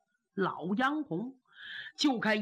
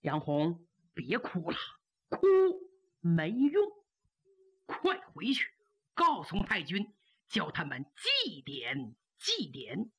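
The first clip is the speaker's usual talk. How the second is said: gently consoling; 6 semitones lower than usual. Acted. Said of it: "又怕杨红过度悲伤".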